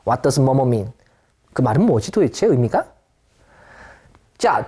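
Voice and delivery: usual speaking voice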